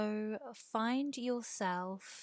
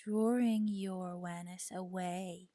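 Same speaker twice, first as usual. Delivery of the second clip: guided meditation. Real, not acted. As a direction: guide them gently toward the breath, unhurried. Leads a yoga nidra meditation.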